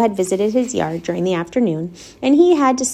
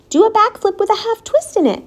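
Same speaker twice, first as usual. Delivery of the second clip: high, excited coaching cry; 12 semitones higher, 3 dB louder than usual. A narrator's reading. Charlotte sets the pig a harder trick, crying out. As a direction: cry out the next trick, pitched up. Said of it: "cried Charlotte".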